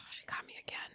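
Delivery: barely voiced, choked-up whisper; teary whisper. Real, not acted. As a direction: breathe it out, overcome with tears. Co-host tearing up at grief story.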